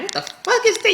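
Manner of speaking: high shocked outburst. Retold or imitated. Scrolling Facebook and seeing something gross, high and shocked. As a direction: recoil at what you see. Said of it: "I'm like".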